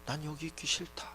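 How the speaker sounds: very soft, withdrawn refusal; quietly refusing God. Acted. God answers Moses's plea, quietly refusing. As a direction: refuse under your breath, as God. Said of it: "여기를 떠나시면 어떻게 합니까".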